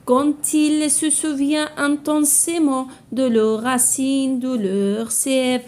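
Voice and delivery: slow, measured poem reading with sustained, level notes; poem recitation. Shown as a demonstration. Teacher reads the poem to pupils, slow measured recitation. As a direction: recite line by line, drawing out the words.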